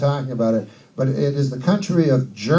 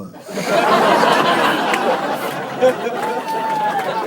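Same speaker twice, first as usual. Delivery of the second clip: high wheezing laugh at his own punchline; big laugh. Real, not acted. Comedian cracking up at his own knock-knock joke. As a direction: burst into a long cackle.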